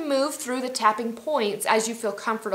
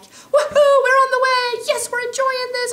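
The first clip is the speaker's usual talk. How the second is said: much higher and louder, whooping with a laugh; jubilant little voice; 12 dB louder, slower than usual. Acted. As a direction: cheer yourself on like a thrilled cheerleader. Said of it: "my little voice is like".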